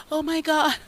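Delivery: hammed-up helpless damsel; gasping like a fainting damsel; mock damsel. Mocking a damsel in distress.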